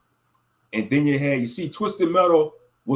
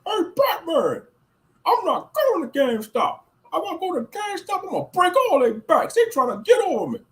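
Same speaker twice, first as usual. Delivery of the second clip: much higher put-on villain voice; Bane voice; 12 semitones higher than usual. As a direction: growl it like the masked villain. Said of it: "Yeah, the band voice".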